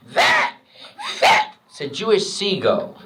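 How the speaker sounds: high shrill aunt's shriek, far up. Retold or imitated. Aunt spots the tattoo, high shocked shriek. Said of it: "she looks and she goes".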